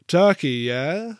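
bright, loud offer; lightly voiced jovial host; cheery host voice. A narrator's reading. Robbo offers turkey.

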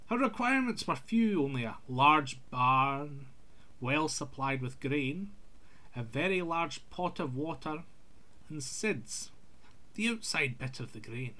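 ordinary delivery

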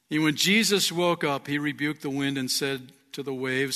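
normal talking voice

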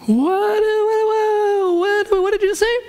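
high, crying-out what what what; shocked disbelief. Retold or imitated. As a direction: squeal in comic disbelief. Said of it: "I'm like".

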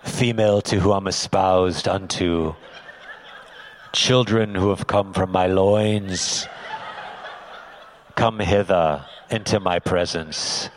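low, loud, grandiose mock-solemn oration; deep, drawn-out pious parody; put-on prayer voice. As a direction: boom it like a pompous pray-er at home. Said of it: "they don't talk like that".

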